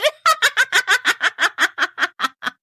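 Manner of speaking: high eerie ghostly cackle; evil cackle. Acted. Ghost woman laughing at the trapped family, evil cackle. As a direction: cackle in triumph.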